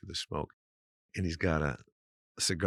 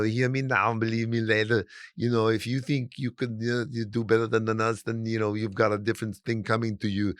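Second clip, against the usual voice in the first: put-on higher, louder Austrian muscleman accent; Hans bodybuilder voice; 4 semitones higher, 4 dB louder than usual. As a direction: boast in a pumped-up Austrian accent like a gym bully. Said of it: "we're talking like Arnold Schwarzenegger".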